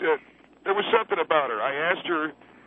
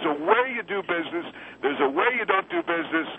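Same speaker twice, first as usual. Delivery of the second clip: indignant; 14 semitones higher than usual. Acted. Indignant lecture.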